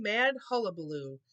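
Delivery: usual speaking voice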